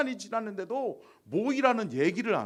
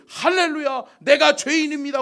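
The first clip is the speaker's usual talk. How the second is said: higher and louder, ecstatic cry; fervent converts; 8 semitones higher, 11 dB louder than usual. Retold or imitated. Pastor imitating Romans repenting, excited higher louder cry. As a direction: shout it like a repentant crowd. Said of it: "사람들이".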